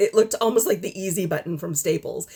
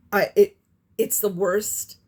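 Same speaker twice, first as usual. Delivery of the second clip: ordinary talk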